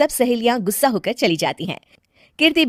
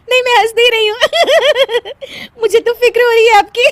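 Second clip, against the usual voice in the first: high, loud protests between laughing fits; concern through laughter; 7 dB louder, faster than usual. Acted. Wife to husband with headache, laughing helplessly. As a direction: insist you care while cackling. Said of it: "ऊपर से तुम हंस रही हो।".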